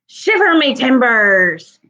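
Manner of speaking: a hint of a pirate growl; lightly voiced pirate cry; pirate exclamation. Shown as a demonstration. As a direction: say it like a salty old pirate. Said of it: "How about this one?".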